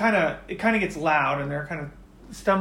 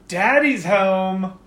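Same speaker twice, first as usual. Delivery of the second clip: slow, loud drawn-out yell from downstairs; man yelling; 3 semitones higher, 8 dB louder, much slower than usual. Acted. Man announces himself downstairs, loud drawn-out yell. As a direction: bellow it as he bursts in the door. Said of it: "some yelling, maybe a man's voice".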